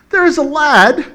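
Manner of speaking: high, loud Irish lilt; put-on Irish accent. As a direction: read it out like an Irishman. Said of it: "all of a sudden he sounds Irish".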